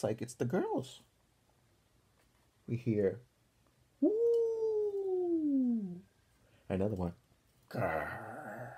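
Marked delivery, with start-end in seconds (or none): 4.0-6.0 high hooting ghost woo, much higher; spooky ghost woo. Pretend. Kids making spooky camp noises. 7.7-8.7 growl. Pretend. Another spooky noise from camp.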